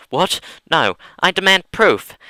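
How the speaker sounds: high loud demand; outraged shout. Real, not acted. [name] protesting the story, shouting high. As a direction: yell it in disbelief.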